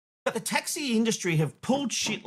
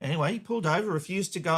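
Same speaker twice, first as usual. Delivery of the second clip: ordinary talk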